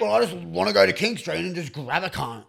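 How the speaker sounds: loud, high and fired up; excited. Real, not acted. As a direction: hype it up. Excited joking about fighting.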